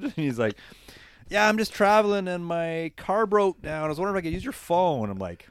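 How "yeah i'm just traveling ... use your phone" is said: lightly voiced stranded stranger; imitating the stranger.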